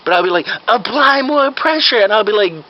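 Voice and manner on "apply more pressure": very high, drawn-out command; urgent fake doctor. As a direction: order it with hammed-up urgency. Fake doctor orders helper.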